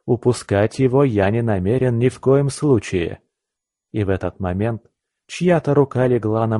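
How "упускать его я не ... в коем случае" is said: usual speaking voice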